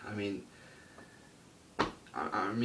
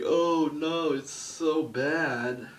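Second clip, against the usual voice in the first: high, louder, very slow whiny mock lament; mocking whiners; 7 semitones higher, 6 dB louder, much slower than usual. Vlogger mocking offended fans, whiny drawn-out voice. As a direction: drag it out like a crybaby. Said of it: "People are like".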